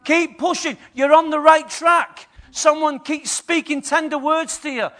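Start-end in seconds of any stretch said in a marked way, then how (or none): 0.1-2.3 loud, slow cheering encouragement; encouraging coach. Acted. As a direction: cheer them on, drawn out. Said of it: "Someone to keep saying".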